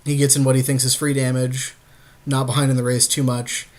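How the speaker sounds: normal talking voice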